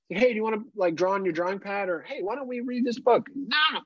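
put-on high, bright coaxing voice to a toddler; sing-song distraction. Dad offers toddler drawing pad in high coaxing voice. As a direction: coax her with a lilting offer. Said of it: "two of those in me".

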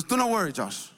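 lightly imitated Kenyan accent, high then dropping. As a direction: reassure with a hint of Kenyan accent. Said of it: "this beautiful, like, Kenyan accent".

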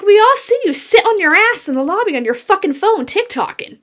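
angry accusation. Retold or imitated. Accusing the manager of slacking, high angry shout. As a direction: throw it in her face.

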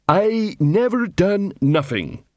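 slow, dull troll voice. A narrator's reading. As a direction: drag it out like a sullen troll. Troll repeats.

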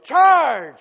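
a sudden loud high battle cry; yelled charge. Retold or imitated. Aunt at the Dodger game yelling charge, loud and high. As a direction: belt it out like a cheering fan. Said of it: "And she yelled".

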